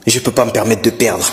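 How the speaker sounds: loud, oblivious inner monologue; thinking out loud. Acted. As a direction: keep thinking aloud at full volume. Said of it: "on entend tout".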